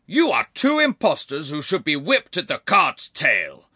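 loud, high, outraged accusation; crying out. A narrator's reading. Peer denouncing two women as impostors, crying out angrily. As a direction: denounce them as an angry lord. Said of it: "cried the peer".

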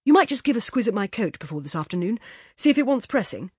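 quick, brisk request; fast-talking casual favour. A narrator's reading. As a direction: rattle it off quickly. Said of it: "said Laurie very fast".

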